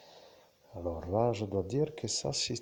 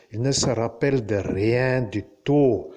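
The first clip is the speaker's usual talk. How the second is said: louder, insistent declaration; emphatic insistence; 2 semitones lower, 7 dB louder than usual. Real, not acted. Telling listeners the human brain remembers nothing, loud and emphatic. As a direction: hammer it out louder, stressing total loss. Insists on total memory erasure.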